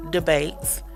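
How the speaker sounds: usual speaking voice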